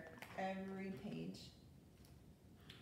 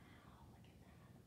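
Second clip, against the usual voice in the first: whisper; 18 dB quieter, slower than usual. Real, not acted. Aside while reading.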